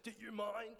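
high mock indignant "Do you mind?"; a pointed retold challenge; dad's pointed rebuke. Dad turning on a shouting parent at softball, mock indignant. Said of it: "be like".

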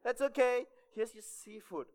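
high-pitched mock waiter; hammed-up table service; imitated eager waiter. Acts waiter answering diner.

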